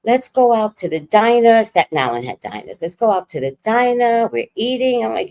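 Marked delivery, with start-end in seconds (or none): 0.0-1.6 higher-pitched imitation of cheerful dad; mildly upbeat retold invitation; best mood. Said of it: "my dad is in the best mood". 2.8-5.0 higher-pitched imitation of cheerful dad; light, upbeat mock-dad invitation; best mood. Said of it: "my dad is in the best mood".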